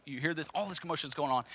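ordinary talk

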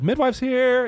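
high-pitched mock call from downstairs; imitated yell. Friend downstairs announcing the midwife's arrival, imitated high-pitched yell. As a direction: imitate calling up the stairs, without real volume. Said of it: "I hear a friend yell from downstairs".

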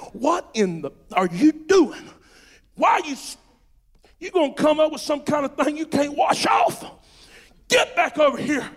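loud, urgent scolding; preacher calling a wandering brother back, shouting; stern rebuke. Acted. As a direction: yank them back with your voice. Preacher voices snatching a sinner back.

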